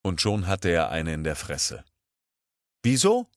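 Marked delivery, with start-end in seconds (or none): 2.8-3.3 high, rising puzzled question; verdutzte Nachfrage. Acted. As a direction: ask it as if baffled by the blow. Puskow fragt verdutzt nach. (verdutzt und hoch nachgefragt)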